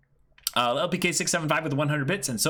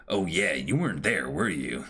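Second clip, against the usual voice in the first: ordinary talk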